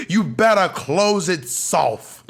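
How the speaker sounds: mock-menacing parent; warning mom. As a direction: imitate a mother's stern threat, spacing out each word.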